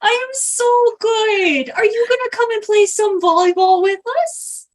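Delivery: gushing invitation. Acted. Greta invites a player to volleyball, gushing. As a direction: coo it high, lifting into the question.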